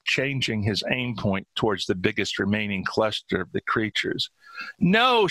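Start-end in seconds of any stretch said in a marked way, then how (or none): 4.8-5.3 high, loud cry of protest; narrator's acted scream. A narrator's reading. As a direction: scream it out in alarm. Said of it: "she screamed".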